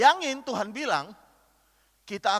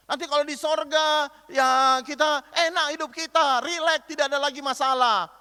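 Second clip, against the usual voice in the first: mock carefree promise; 6 semitones higher, 5 dB louder than usual.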